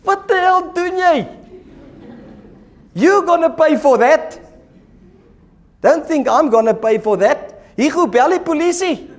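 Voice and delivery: high, loud, furious rant about the roof; outraged homeowner. Pretend. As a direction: yell at the guy who broke your roof. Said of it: "What would you and I do, Andre, if that was our house".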